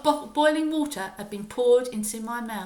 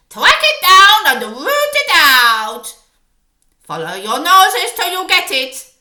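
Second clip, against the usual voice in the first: very loud, higher witch voice giving orders; witch's screamed orders; 9 semitones higher, 19 dB louder than usual. Acted. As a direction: drive the pack on, shouting. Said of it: "screamed the Grand High Witch".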